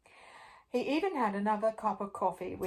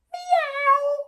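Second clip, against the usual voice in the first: very high, loud meow; high loud silly meow; cat meow; 11 dB louder than usual. Acted. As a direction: mew like a cat. Chicken says meow.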